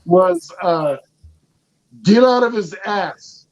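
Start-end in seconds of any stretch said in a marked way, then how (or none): none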